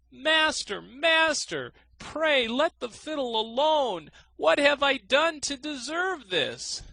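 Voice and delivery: high, loud frantic begging; desperate pleading. A narrator's reading. Miser in the thorns begging the musician, high and loud. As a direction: beg the fiddler to stop. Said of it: "cried the miser".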